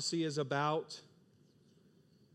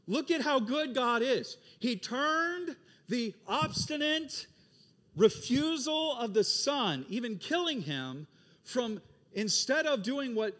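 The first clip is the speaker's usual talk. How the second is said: much higher, slow, loud preaching emphasis; fervent preaching; 10 semitones higher, 5 dB louder, slower than usual. Real, not acted. Preacher to the church, fervently stressing God's goodness. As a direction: preach it with rising fervor.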